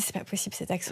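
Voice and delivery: near-whispered inner thought; dismayed self-talk. Retold or imitated. Radio host despairing to herself over her English accent, hushed dismay.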